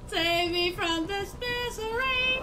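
high, slow, sustained plea; drawn-out wail. Real, not acted. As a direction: wail it with every syllable stretched. Begging for rescue in game.

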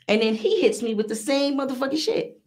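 ordinary delivery

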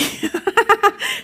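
high, bubbling laugh at the punchline; amused high laughter; real laugh. Real, not acted. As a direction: laugh out at your own joke. Speaker laughs at own joke.

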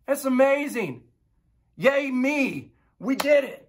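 high, bright excited cheer; God cheering over creation; God delighted. Retold or imitated. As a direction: celebrate like a thrilled creator. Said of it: "He looks at it and he goes".

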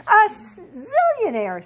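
squealing little bunny, very loud; excited bunny. Acted. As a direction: blurt it out topping your friend. Stumpy outdoes Grace excitedly.

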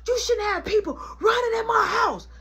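high-pitched indignant telling-off; angry scolding. Acted. Mama scolding about guests, high indignant voice.

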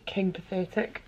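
ordinary delivery

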